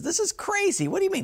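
high, baffled disbelief; caught-off-guard buyer, high and puzzled; incredulous. Retold or imitated. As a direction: squeak out your disbelief. New owner baffled by horse cues.

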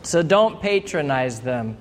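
ordinary talk

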